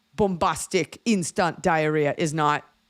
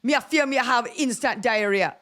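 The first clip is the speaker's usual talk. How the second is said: imitating Jamaican accent; 7 semitones higher than usual.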